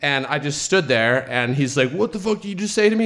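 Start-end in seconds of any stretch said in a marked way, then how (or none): 1.9-3.0 fast, high-pitched angry challenge; mock outrage, rushed and raised in pitch; aggressive confrontation. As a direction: imitate an aggro guy demanding an answer. Aggro man confronts them, retold.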